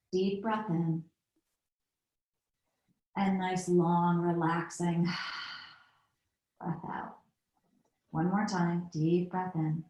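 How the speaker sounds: soft, slow breathing guidance; quiet relaxing breath-out cue; guided breathing cue. Acted. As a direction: draw the breath out for them.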